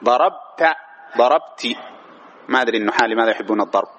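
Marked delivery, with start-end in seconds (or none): 0.0-0.8 "ضربت" higher, broken as darab-ta; demonstrating fatha. Shown as a demonstration. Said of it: "مع التاء تقول إيش؟". 1.2-1.8 "ضربتِ" ending in a clear -ti; demonstrating kasra. Shown as a demonstration. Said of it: "مع التاء تقول إيش؟".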